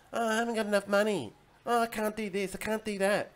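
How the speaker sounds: lightly mock the moaners; mock whiny complainer.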